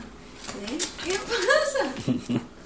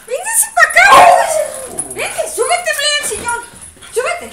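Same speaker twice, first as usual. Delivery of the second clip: loud, raised-pitch call to a dog; 8 dB louder, faster than usual. Real, not acted. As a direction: order the dog over, loudly. Calling the dog over. (llamando a gritos al perro)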